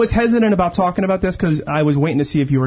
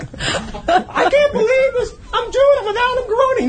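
smitten woman. Retold or imitated. Imitating a woman entranced by Adam, high mock-swooning falsetto. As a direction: squeal it like an entranced groupie, hammed-up. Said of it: "You can be like".

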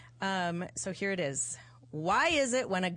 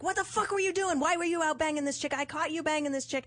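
high, pitched-up pretend rant at a cheating boyfriend; mock angry yelling; 8 semitones higher than usual. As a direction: play the furious girlfriend, voice way up. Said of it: "So if I yelled at you".

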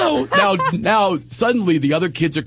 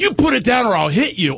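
threatening wife; 9 semitones higher than usual. Retold or imitated. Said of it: "she actually said".

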